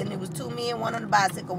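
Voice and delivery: normal talking voice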